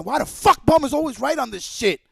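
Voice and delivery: ordinary talk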